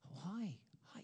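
faint startled reply; shocked whisper. Retold or imitated. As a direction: barely voice it, caught off guard. Shocked girl answers.

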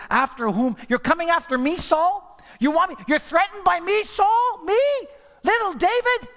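high, loud pleading David; voicing David. Preacher as David shouting to Saul, high incredulous plea. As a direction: cry out high as David, amazed he's hunted.

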